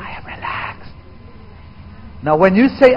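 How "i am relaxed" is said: soft unvoiced whisper. Shown as a demonstration. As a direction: breathe it with no voice.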